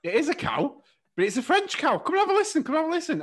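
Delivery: excitable, high-pitched comic routine; Peter Kay-style animals. Acted. Podcaster voicing animals in a Peter Kay bit, excitable high voice. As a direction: call it out high, leaping up at the end. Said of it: "it's at the animals going".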